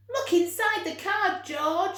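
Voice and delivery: high, loud excited urging; eager animals. A narrator's reading. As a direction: push him to look, thrilled. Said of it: "said all the animals".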